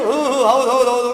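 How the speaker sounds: grinning, eager agreeing; fawning yes-man. Retold or imitated. Greedy poor man agreeing with the rich, fawning and grinning. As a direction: nod along to flatter the rich man. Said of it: "ಅಂತ ಹಲ್ಲು ಕಿರಿತಿರ್ತಾನೆ".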